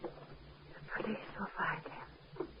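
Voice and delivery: slow, dazed whisper. Acted. Wife echoing the doctor in a stunned whisper. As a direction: breathe it to herself, stunned.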